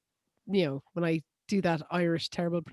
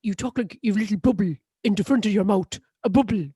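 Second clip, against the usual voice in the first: Englishman's overdone stage-Irish; mock Irish accent; 3 dB louder than usual. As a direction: imitate a tutor hamming up bad Irish. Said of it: "we're doing an Irish accent today".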